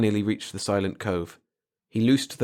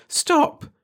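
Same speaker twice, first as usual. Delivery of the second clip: sudden high loud "Stop!"; cry of stop; 6 dB louder than usual. Acted. Girl cuts off Jack's talk of the future, crying out high and loud.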